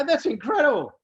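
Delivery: high, bright mock amazement; amazed reaction. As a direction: gush like a wowed listener.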